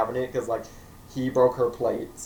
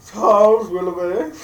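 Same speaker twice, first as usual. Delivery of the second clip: grand announcement; 8 semitones higher, 11 dB louder than usual. Acted. Introducing the butler character grandly, booming. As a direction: announce him like royalty.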